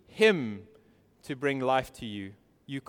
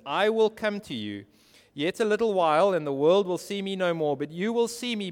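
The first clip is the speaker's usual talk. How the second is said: high, loud pulpit proclamation; impassioned scripture reading; 5 semitones higher, 6 dB louder than usual. Real, not acted. Preacher reading Jesus' promise to his disciples, impassioned. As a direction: proclaim the promise with sustained force. Reads scripture at full force.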